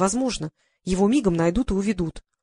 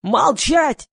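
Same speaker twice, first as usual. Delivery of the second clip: high, loud yell for silence; 6 semitones higher, 5 dB louder than usual. A narrator's reading. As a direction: shriek the order above the hubbub. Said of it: "завопил Гриша".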